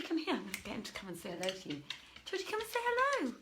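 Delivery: usual speaking voice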